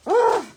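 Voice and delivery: loud frustrated ugh; dismayed groan. Real, not acted. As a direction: groan at the spill. Dumped everything on the floor.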